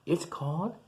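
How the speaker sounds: usual speaking voice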